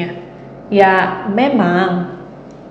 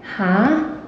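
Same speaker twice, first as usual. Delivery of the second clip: "Hah" gliding upward; puzzled question; 3 dB quieter than usual. Shown as a demonstration. Someone who does not understand, asking a puzzled rising "Hah". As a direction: ask "Hah" with a clear rise, not understanding. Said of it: "heran gitu".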